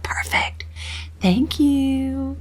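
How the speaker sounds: breathy whispered thanks; whispering. Real, not acted. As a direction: drop to a real whisper.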